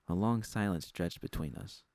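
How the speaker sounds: usual speaking voice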